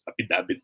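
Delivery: "Affidavit" said as ah-pi-DA-bit; soft, low "Affidavit" with P for F, B for V; local P for F. Retold or imitated. Teacher quotes how it's sometimes said, local apidabit pronunciation. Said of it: "pa nga minsan".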